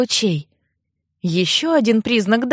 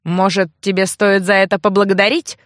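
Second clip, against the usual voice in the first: ordinary delivery, nothing marked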